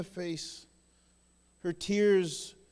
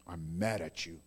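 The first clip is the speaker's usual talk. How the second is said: mock anger; 14 semitones lower, 7 dB quieter than usual. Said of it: "Does he go".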